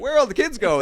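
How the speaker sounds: high, loud puzzled cry; mock bewilderment. Swimmer wondering at the emptied pool, mock bewildered call. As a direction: call out baffled, hammed-up.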